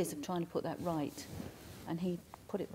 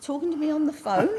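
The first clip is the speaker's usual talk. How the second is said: high, loud astonished outburst with a laugh; 10 semitones higher, 10 dB louder than usual. Retold or imitated. Medium hears her husband's spirit on the phone, high, loud and laughing in disbelief.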